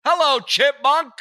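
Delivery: very high, loud, slow greeting; imitated radio drop. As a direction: sneer it in the drop voice. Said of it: "I always love that one".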